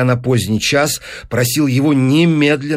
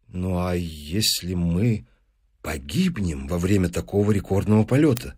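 apprehensive; 6 semitones lower, 6 dB quieter than usual. Acted. Professor asking about dying in flight, apprehensive. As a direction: voice the fear quietly, pausing midway.

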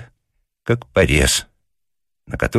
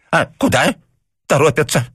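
put-on higher, sharp grumble of an old man; an irritated papasha answering the policeman; angry retort; 11 semitones higher than usual. A narrator's reading. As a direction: snap back crossly. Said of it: "сердито заметил папаша".

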